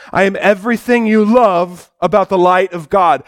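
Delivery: ordinary delivery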